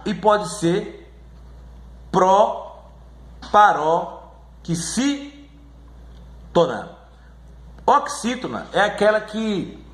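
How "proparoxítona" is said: very slow pro-pa-ro-xí-to-na with long gaps; syllable by syllable. Shown as a demonstration. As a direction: break "proparoxítona" into chunks with pauses. Teacher sounding out term for class.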